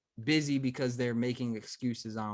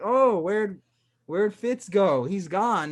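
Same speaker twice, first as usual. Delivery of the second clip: higher, louder playful mock bafflement; mock peekaboo surprise; 6 semitones higher, 6 dB louder, slower than usual. Host imagines playing peekaboo with an adult, mock surprise. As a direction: act out an exaggerated peekaboo search. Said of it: "Imagine adult being like".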